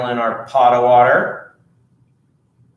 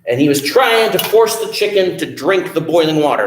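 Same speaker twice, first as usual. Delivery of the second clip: ordinary delivery, nothing marked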